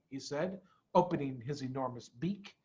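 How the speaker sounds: plain reading voice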